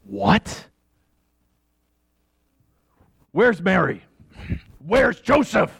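sudden high, startled questions; shocked outburst. Acted. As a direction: jolt of disbelief. Father hears daughter is pregnant.

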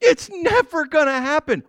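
It's never gonna happen, again high and amused; incredulous repeat. Real, not acted. Preacher to congregation, amused disbelief. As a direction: repeat it through a chuckle.

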